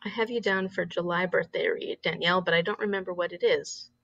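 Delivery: ordinary delivery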